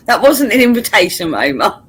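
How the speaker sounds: high, loud, laughing protest; laughing through the words. Real, not acted.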